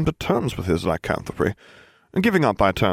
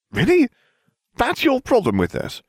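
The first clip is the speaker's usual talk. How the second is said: incredulous. A narrator's reading. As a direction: sound utterly taken aback. Harry incredulous.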